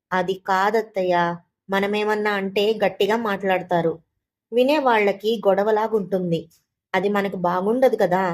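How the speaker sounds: plain reading voice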